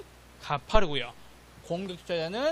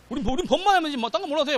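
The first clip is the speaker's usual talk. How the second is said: mock law teacher; 9 semitones higher, 4 dB louder than usual. Mocks law teachers' attitude.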